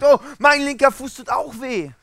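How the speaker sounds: high, loud hammed-up moan; mock complaint of pain. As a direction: act out a sore foot, loudly and high. Third sufferer acted in illustration. (übertrieben wehleidig, hoch und laut)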